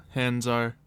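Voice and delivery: ordinary talk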